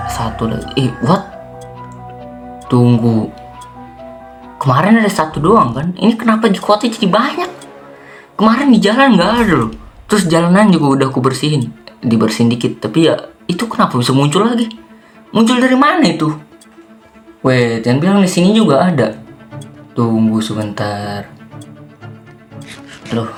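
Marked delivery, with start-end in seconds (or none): 4.6-11.7 high-pitched, incredulous complaint; incredulous protest. Acted. Player finds the cleared road full of zombies, incredulous. 12.8-19.2 high, baffled outburst; exasperated disbelief. Acted. Player baffled that zombies came back, exasperated. Zombies reappear on cleared road.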